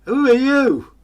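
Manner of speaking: high, loud demanding voice; haughty knight. Acted. Knight pursuivant demanding the tramp's name, high and loud. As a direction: bark the question down at him.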